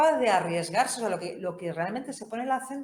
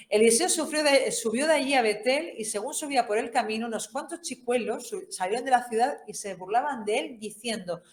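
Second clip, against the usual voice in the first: lectura dramática; 4 semitones higher, 4 dB louder than usual. Acted. Lee el texto con fuerza.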